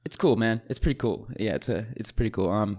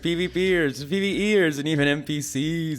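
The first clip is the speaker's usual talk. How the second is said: podcast announcer; 3 semitones higher, 3 dB louder than usual. Acted. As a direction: kick off the show loudly, then settle. Podcast show intro.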